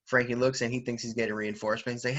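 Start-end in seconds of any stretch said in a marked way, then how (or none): none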